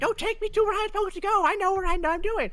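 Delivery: very high, fast, loud whine; mocking imitation. Captain mimics clueless wandering souls, high fast mocking voice. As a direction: squeal it mockingly in a rush. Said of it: "be like".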